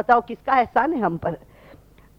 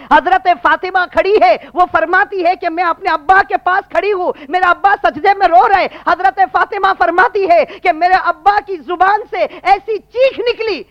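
high, forceful sermon voice; impassioned preaching; 8 semitones higher, 7 dB louder than usual. Acted. Preacher telling of Fatima watching her father, impassioned. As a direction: preach it in a raised, urgent cry.

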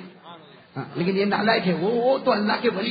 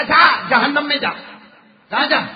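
angry boss; 5 semitones higher, 5 dB louder than usual. Retold or imitated. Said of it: "गुस्से में मालूम नहीं उसको जो मुंह में आया बोला".

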